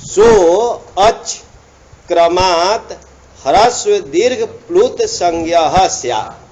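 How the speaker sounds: slow measured chanting of the rule; sutra recitation. Shown as a demonstration. Teacher reciting the sutra to students, measured chant. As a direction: recite the sutra deliberately, word by word.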